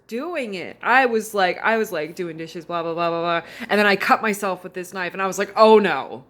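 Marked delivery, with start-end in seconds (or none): 5.6-6.2 loud sudden cry; mock alarm. Retelling cutting herself on the knife, in a loud mock cry. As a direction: blurt it out in mock panic.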